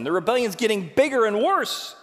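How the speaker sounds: usual speaking voice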